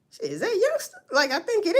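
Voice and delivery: high, unsure question; half-asking, half-recognizing, unsure; confused. Retold or imitated. Confused witness wondering aloud who the driver is. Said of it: "He confused".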